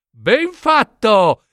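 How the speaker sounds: high, slow, drawn-out praise; delighted approval. Acted. King's daughter applauds the mud in the scribe's face, delighted.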